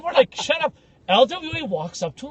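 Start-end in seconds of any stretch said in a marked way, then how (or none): 0.3-0.7 raised-pitch, dismissive telling-off; mock exasperation. Teammates to the wailing Italian guy, exasperated. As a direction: cut off the drama queen.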